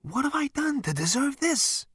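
very high, plaintive wail without extra volume; perplexed. A narrator's reading. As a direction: protest in helpless bewilderment. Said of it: "shook his head in perplexity".

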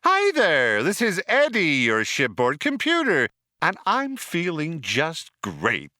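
high, loud gushing computer; a chirpy overeager ship computer; cheery computer voice. A narrator's reading. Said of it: "it burbled".

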